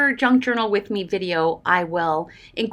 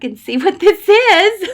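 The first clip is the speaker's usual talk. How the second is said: excited laughter; 11 semitones higher, 10 dB louder than usual. Real, not acted. Opening a gift, laughing and teary.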